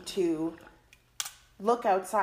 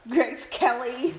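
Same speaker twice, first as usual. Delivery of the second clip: drawn-out, sing-song "Grace Kelly"; playful; 5 semitones higher, 7 dB louder, slower than usual. Pretend. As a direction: tease the name "Grace Kelly", slow and lilting. Jokingly repeating the famous name.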